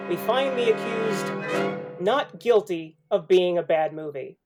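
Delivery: usual speaking voice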